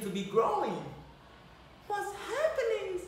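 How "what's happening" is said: high, startled little cry; high surprised squeak. Acted. Tiny Clara wakes bewildered under the tree, high and startled. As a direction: gasp it out in alarm. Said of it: "she said".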